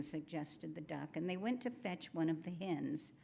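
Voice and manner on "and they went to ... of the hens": plain reading voice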